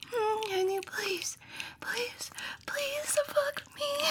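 soft, high unvoiced plea that fades to almost nothing; breathless begging whisper, slow on the last please.

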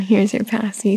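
ordinary talk, nothing marked

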